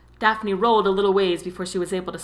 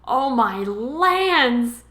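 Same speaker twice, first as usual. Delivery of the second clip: high, loud, exhilarated exclaiming; laughing out loud; 6 semitones higher, 6 dB louder than usual. A narrator's reading. Daphne exclaims to Tom after the crash, laughing excitedly. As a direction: burst out, giddy after the scare. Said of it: "she laughed out loud again".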